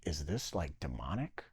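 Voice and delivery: very low, hushed questioning; hushed private doubt. Retold or imitated. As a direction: drop right down and ask it warily. Retold uneasy private doubt.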